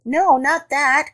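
high, drawn-out rabbit protest; crying out. A narrator's reading. Rabbit correcting Elephant's guess, high cry. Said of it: "cried the rabbit".

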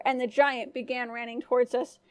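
ordinary delivery

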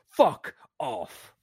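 loud, high-pitched outraged cursing; swearing bloody murder. Acted. Driver retelling his outburst at the traffic cop, furious swearing. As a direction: explode in disbelief, voice leaping up. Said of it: "I'm swearing bloody murder at him now".

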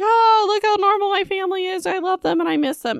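high, mocking sing-song imitation; mocking Pip. Recapper mocking Pip at family dinner, high and sneering. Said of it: "It's really annoying".

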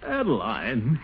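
ordinary talk

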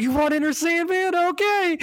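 very high, squealing delighted voice; excited rock star. Retold or imitated. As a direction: squeal it like a thrilled frontman. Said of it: "He's like".

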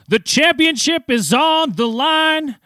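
high, drawn-out championship announcement; slow, hammed-up fight-night hype; ring announcer. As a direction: build to a long shout on the final words.

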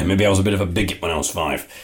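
ordinary talk, nothing marked

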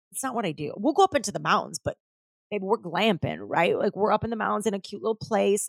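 ordinary delivery

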